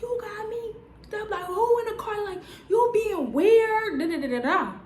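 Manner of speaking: high, squeaky mock yelling; mock shouting at him. Reenacting yelling at boyfriend in the car, high mock shout. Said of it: "I'm swinging, like".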